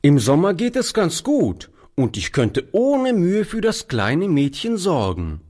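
put-on high boy's voice, firm and sure; boy insisting to the old man; determined boy. A narrator's reading. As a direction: state it firmly as the boy. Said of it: "erklärte der Junge bestimmt".

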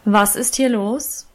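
slow stern teacher's question. A narrator's reading. Teacher breaking up the schoolyard fight, slow and stern. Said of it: "aber noch tiefere Stimme".

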